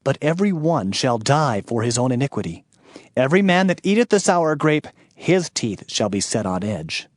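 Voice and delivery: ordinary talk, nothing marked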